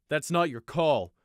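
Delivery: loud, slow captain's reproof; stern rebuff. A narrator's reading. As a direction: shut him down with authority.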